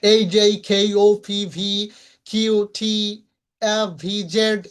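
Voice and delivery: letters rattled off distinctly in small groups; clearly articulated letter drill; clear recitation. Shown as a demonstration. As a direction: recite the alphabet letters crisply for students. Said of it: "স্পষ্ট করে বলতে হবে".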